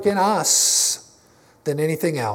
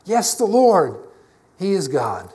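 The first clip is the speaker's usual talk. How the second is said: preacher voicing a crowd's cry; slower than usual. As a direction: proclaim it like an awestruck crowd. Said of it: "They cried".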